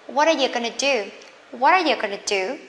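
fast, run-together phrasing; brisk reduced teacher's model; quick linked speech. Shown as a demonstration. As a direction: link the words into one quick stream. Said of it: "learn how to speak this one quickly".

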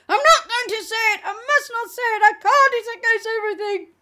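high, fast frantic demon yell; demon shouting. A narrator's reading. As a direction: bark it out in a frenzied demon voice. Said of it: "The demon barked".

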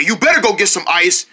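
usual speaking voice

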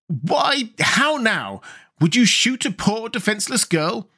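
high-pitched, reproachful questioning. A narrator's reading. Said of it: "said reproachfully".

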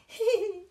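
short high giggling burst; quick delighted giggle. Acted. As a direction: giggle like the whole family. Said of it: "Everyone giggled".

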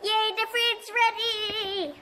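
high, slow cheery voice; put-on voice. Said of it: "Why does your voice change all the time".

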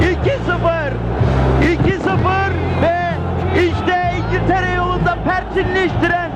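ecstatic goal shout. Real, not acted. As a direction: scream the goal with joy. Goal call in live commentary.

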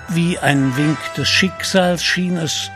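normal talking voice

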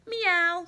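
mock cat meow; a bright imitated meow. Cat answers Duck with a mock meow.

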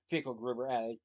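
plain reading voice

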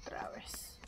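soft unvoiced whisper of defeat. Real, not acted. Player caught again, whispering. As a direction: breathe it out under your breath.

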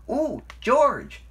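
high, loud burst of inspiration; delighted high exclamation; excited cry. A narrator's reading. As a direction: call out a sudden bright idea. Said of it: "cried Thomas".

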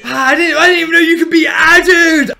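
shocked outcry. Real, not acted. Player shocked an enemy was added, loud outcry. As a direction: blurt it out in shock.

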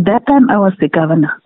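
ordinary delivery

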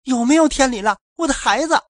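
high, loud desperate cry; mother screaming for her child. A narrator's reading. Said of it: "声嘶力竭的喊".

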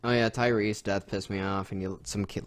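ordinary talk

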